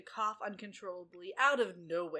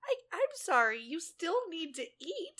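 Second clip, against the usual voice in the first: mock indignant; 8 semitones higher, 6 dB louder than usual. Mock husband retort.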